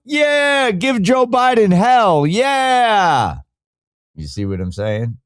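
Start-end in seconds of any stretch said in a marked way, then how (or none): 0.1-3.4 high, drawn-out rallying cheer; imitating his own high, whooping cheer. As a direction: cheer it slowly like a roused crowd. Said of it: "I was cheering, like".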